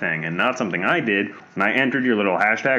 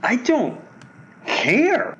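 defiant; 12 semitones higher, much slower than usual. Real, not acted. Defiant reply.

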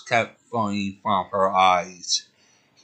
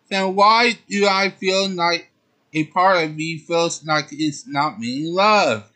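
hurt heroine; 7 semitones higher than usual. Acted. Sailor Moon asks sadly.

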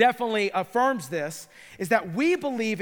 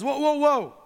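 urgent calling out; 5 semitones higher than usual. Retold or imitated. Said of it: "Paul says".

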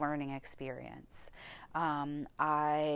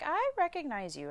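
higher-pitched quoted voice; imitated recognizing manager; 8 semitones higher than usual. Nurse manager spotting a familiar face at interview, high imitated recognition. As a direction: lift the pitch as you mimic her.

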